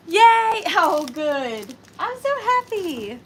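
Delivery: loud, high yay; real delighted whoop; excited cheer. Real, not acted. She wins the cats.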